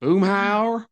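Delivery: high, loud cartoon mumble; hammed-up drawling character voice; Boomhauer. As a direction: blurt the name as a mocking impression. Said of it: "it's like, can you just boomhauer".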